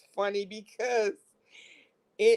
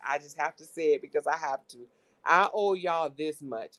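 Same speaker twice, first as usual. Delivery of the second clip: ordinary talk, nothing marked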